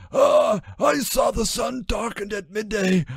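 high, ragged, pleading voice; high anguished testimony; stammering Judas. A narrator's reading. Judas taken aback, explaining to the voice, high and ragged. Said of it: "He stammered".

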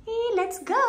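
a jump high up, bright cheer to set off; excited let's go. Real, not acted. Vlogger setting off to the city, eager cheer. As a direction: launch it like a hype call. Cheerful send-off after outfit check.

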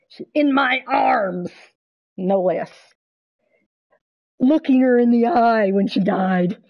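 loud, slow, drawn-out anguish; wounded, emphatic insistence close to tears; intense, grieving. Real, not acted. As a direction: cry it out with real grief. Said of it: "That was an intense moment".

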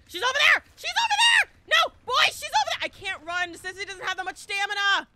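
high, loud shouts to teammates; excited shouting. Real, not acted. Calling her location to teammates, high shouting. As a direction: scream out where she is. Spotting victim, yelling to team.

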